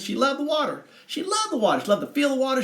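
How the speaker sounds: lifted high and dreamy on loved; dreamy emphasis. Shown as a demonstration. Telling viewers how the girl loved water, lifted high. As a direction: she loved the water sung out high, lingering. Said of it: "repeated with rising emphasis".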